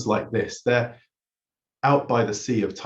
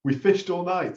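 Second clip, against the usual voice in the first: higher, raised call back; 7 semitones higher, slower than usual. Retold or imitated. Disciples calling back to the stranger on shore. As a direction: shout the reply back to shore. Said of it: "And they call out".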